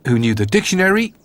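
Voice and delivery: ordinary delivery, nothing marked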